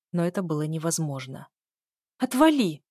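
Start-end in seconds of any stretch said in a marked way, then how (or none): 2.2-2.8 higher, louder snapped retort; angry shout back. A narrator's reading. Heroine to the sister following her, shouting back. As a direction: shout it back to shake someone off. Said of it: "я крикнула в ответ".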